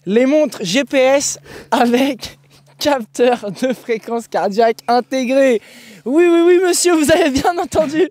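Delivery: mock-triumphant product reveal; mock advert announcer. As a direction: hype it up like a teleshopping host.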